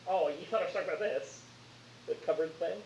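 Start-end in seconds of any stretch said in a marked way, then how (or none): none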